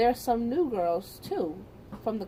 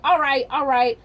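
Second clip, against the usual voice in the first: raised, higher voice cutting in; teacher calling order; 5 semitones higher, 8 dB louder than usual. Retold or imitated. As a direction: mimic a teacher breaking up a squabble. Teacher breaking up a fight.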